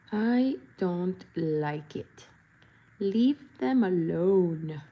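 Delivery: I don't like it said slowly; slow firm repeat. Real, not acted. Protesting grown-up cartoons, slow and firm. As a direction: insist slowly on each word. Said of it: "repeats slowly".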